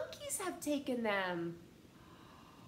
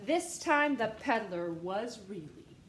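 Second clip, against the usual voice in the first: plain reading voice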